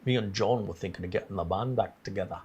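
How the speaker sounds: put-on a light, admittedly shaky Scouse accent; mock Scouse accent. Streamer reading a viewer's Liverpool super chat, trying a Scouse accent. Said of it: "Is that the Scouse accent".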